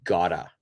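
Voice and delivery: "gotta" said as kah-tuh, soft flapped t; gotta pronunciation. Shown as a demonstration. Teacher models reduced got to as gotta. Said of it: "change your pronunciation to".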